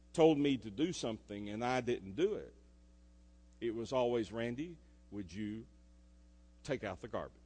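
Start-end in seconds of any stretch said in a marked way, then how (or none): 4.3-7.4 a softer, lower mom asking sweetly; mom's gentle ask. Retold or imitated. Mom asking her son to take out the garbage, gently. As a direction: ask it nicely, like a patient mom. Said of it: "Kind of in that voice".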